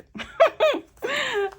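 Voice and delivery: very high burst of laughter. Real, not acted. Reader amused by her unicorn threat, laughing. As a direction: crack up in a high giggle.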